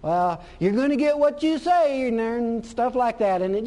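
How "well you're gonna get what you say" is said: high, prim scolding voice; scolding picky believer. Retold or imitated. Picky church people correcting a complainer, high prim scold. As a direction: wag a finger at a bad confession. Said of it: "they come along and tell you".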